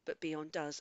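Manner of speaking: ordinary talk, nothing marked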